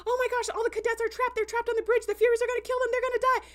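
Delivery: high, rushed mock freak-out; panicking. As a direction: blurt it out in a hammed-up panic. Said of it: "she just starts freaking out and, like, panicking".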